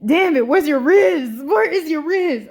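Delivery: loud exasperated complaint at Atreus; exasperated yell. Retold or imitated. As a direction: yell at him like a frustrated fan. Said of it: "I was like".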